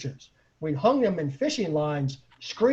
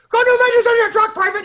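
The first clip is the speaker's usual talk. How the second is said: much higher, louder, fast barked command; barked order; 10 dB louder, faster than usual. Retold or imitated. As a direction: bark it like a drill sergeant at a private. Drunk ordering soldier around.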